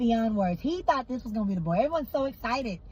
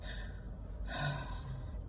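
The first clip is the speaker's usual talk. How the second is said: long, breathy unvoiced sigh; deep sigh; 8 semitones lower, 11 dB quieter than usual. Other woman sighs before admitting the truth, a deep breath. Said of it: "she takes some deep breaths".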